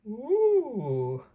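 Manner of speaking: high, drawn-out ooh of wonder; impressed ooh. Real, not acted. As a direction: marvel at the page. Reader reacts to picture.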